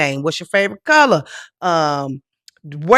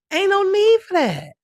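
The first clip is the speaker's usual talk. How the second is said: usual speaking voice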